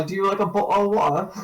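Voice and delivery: quick Cockney with the T in "bottle" and "water" dropped; Cockney accent. Shown as a demonstration. Showing a friend how consonants drop, Cockney accent. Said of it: "T sound gets just dropped".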